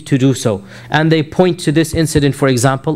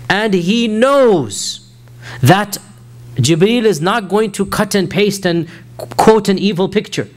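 impassioned preaching; 6 semitones higher than usual. Acted. Preaching with rising fervour.

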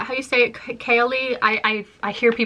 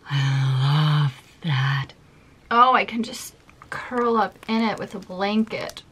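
dreamy swoon. Real, not acted. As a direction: melt into it like a blanket. Swooning over the scent.

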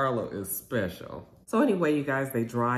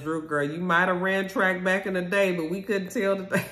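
teasing jab; 7 semitones higher than usual. Real, not acted. Teasing Drew after the race.

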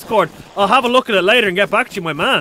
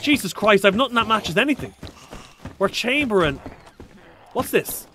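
normal talking voice

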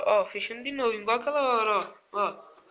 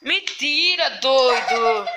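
laughing; 10 dB louder, much slower than usual. Real, not acted. Relief after prank reveal, laugh.

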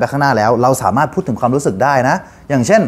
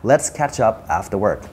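1 softer repeat; 4 semitones lower, 6 dB quieter, much slower than usual. Shown as a demonstration. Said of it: "รวมประโยคครับ".

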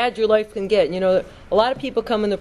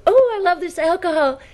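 higher, giddy mock voice of a tipsy woman; 10 semitones higher than usual. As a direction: play the happy drunk girlfriend, pitched up. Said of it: "And she goes".

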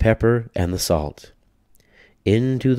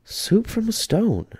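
put-on very high, slow, amazed old lady; old woman's high amazement; little old lady, marvelling. A narrator's reading. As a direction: gasp it out in wonder. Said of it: "said the little old lady".

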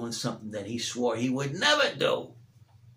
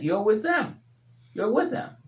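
insisting, pitched high; sing-song taunt at Peter; high mock accusation; 9 semitones higher than usual. Bystanders insist.

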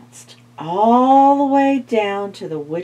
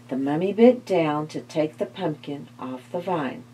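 ordinary delivery

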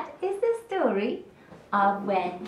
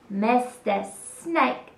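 usual speaking voice

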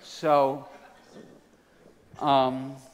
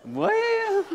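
drawn-out call climbing into high falsetto; mounting high churchwoman's approval; church women responding; 12 semitones higher, much slower than usual. Acted. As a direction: climb higher on each one, like women in the pews. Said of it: "he was hearing".

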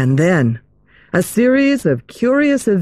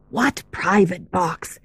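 hushed question; 5 dB quieter than usual. Acted. Other girl whispers.

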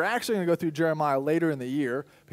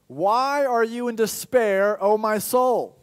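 higher, louder, very slow plea; pleading question; 6 semitones higher, 6 dB louder, much slower than usual. Retold or imitated. Psalmist questioning his own soul, slow pleading cry. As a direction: call out to your own soul, slowly. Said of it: "the psalmist says".

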